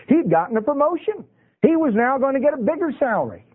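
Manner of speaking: high-pitched, playful excitement on another's behalf; mock excitement. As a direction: play up the priest's delight, pitched high. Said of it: "was he excited".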